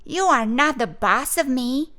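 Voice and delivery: mad. A narrator's reading. Girl standing up to bossy boy, mad. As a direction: push back with mild anger. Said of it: "Flossie got mad".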